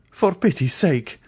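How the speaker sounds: high desperate plea; pleading cry. A narrator's reading. Andrea begging the two ladies, high frightened plea. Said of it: "he cried, pale and bewildered".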